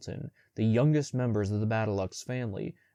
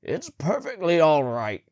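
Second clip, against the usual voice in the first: slow, rough-edged voice of a big man; deep gravelly voice; 7 semitones higher, 7 dB louder than usual. A narrator's reading. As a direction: gravel in the throat, unhurried. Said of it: "he said in a deep, gravelly voice".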